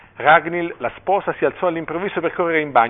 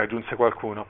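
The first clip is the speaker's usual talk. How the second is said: ordinary delivery, nothing marked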